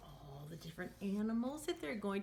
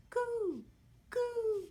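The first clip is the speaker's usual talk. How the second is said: high birdlike coo; dove coo; 4 dB louder than usual. Pretend. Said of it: "don't forget to coo".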